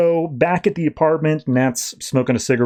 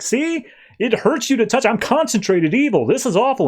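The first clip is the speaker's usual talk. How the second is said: much higher, whiny melodramatic wail; mock despair; 10 semitones higher than usual. Clayface showing Ragman his burn, high melodramatic whine. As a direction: whine it like a tragic monster.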